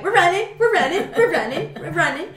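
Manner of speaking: a lightly voiced excited dog, half laughing; voicing the dog. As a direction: play the dog having fun. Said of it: "Tuki's just like".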